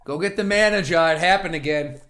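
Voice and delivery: ordinary talk, nothing marked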